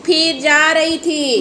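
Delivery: slow, stretched-out words; drawn-out storytelling. Acted. Mother stalls her story on the walk, drawn out. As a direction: drag the walk out, very slowly.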